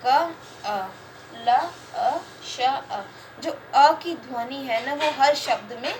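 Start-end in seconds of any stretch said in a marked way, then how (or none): 0.1-3.0 क, अ, ल, अ, श, अ said one sound at a time with pauses; teacher sounding out कलश for a class. Shown as a demonstration. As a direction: split कलश into single sounds, each one separate. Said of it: "कलश में भी चार आ रही है".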